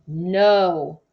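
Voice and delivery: a single emphatic refusal. A narrator's reading. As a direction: shut it down with a loud No. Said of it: "I told her emphatically".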